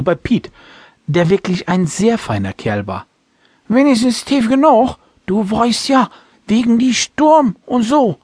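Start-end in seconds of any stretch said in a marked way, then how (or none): 3.7-8.2 put-on high, loud drunk old sailor; drunken slurring. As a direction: warn him like a tipsy old captain. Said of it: "gab der alte Captain zu bedenken".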